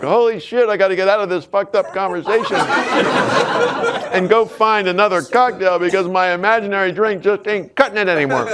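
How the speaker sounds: high, laughing panic to leave; giggling, desperate to get away; laughing escape. Pretend. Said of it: "what they do is like".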